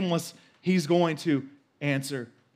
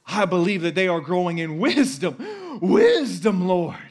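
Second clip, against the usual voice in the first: louder, fervent declaration, peak on Wisdom; preacher modeling a bold prayer; bold proclaiming prayer; 2 semitones higher, 5 dB louder than usual. Pretend. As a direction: cry it out in faith. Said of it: "bold and shameless to proclaim".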